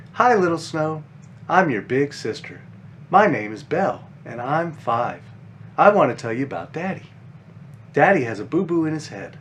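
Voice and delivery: put-on gentle, lively storybook read for kids; reading to children. A narrator's reading. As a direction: read it like a bedtime picture book. Reads children's book aloud.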